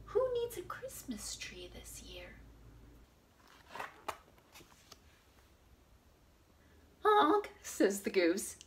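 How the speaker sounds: lively storybook read-aloud; reading to children. Acted. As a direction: read it out to little kids. Reads picture book to children.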